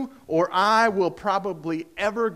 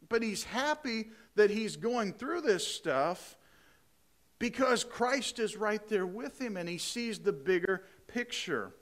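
ordinary delivery

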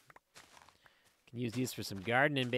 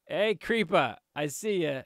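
loud, higher shout-out; playful taunting call; 5 semitones higher, 10 dB louder, slower than usual. Pretend. Player taunts a creeper he has spotted, loud playful call. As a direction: call out loudly to a foe you've spotted.

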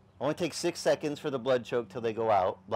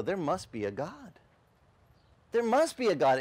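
growing amazement; 6 semitones higher than usual. Retold or imitated. Onlookers realising God is real, rising excitement. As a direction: build from wonder to exclaim. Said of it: "people start to go".

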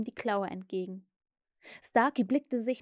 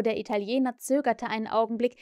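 ordinary delivery

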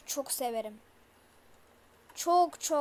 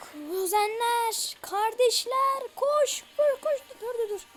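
high, loud frantic calls to run; panicked yelling; 7 semitones higher, 7 dB louder than usual. Real, not acted. Gamer fleeing the monster, yelling in high panic. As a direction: scream in real panic.

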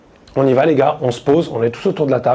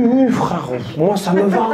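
ordinary delivery

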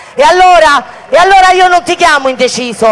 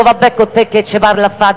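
Roman dialect; 6 semitones lower than usual. Retold or imitated. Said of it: "permettetemi il romano".

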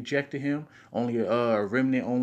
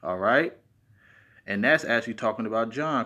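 usual speaking voice

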